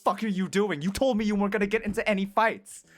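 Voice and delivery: imitated exasperated reproach; mock scolding. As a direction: lightly play a worried telling-off, speeding up at the end. Nurse scolds her injured boyfriend.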